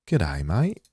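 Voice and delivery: decent attempt at an Australian accent. Designer practising for his font sample, Australian accent. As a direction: try an Australian greeting, lifting the end. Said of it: "that one, that wasn't bad".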